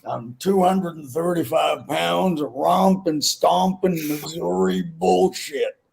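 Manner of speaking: put-on booming Missouri accent, slowing at the end; hammed-up Missouri Don; Don's Missouri drawl. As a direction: brag like a big Missouri man. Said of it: "He goes".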